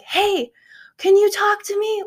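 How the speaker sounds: high-pitched mock call over noise; mock shouting. Believer calling to God over the noise, mock shouting. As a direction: call out high, imitating a shout without full volume. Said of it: "I'm shouting over the noise to God".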